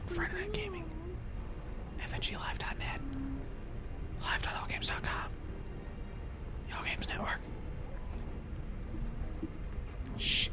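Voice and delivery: hushed unvoiced whisper. Streamer whispering plugs while sneaking in game. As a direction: whisper like you're sneaking past guards. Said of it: "We're sneaking around".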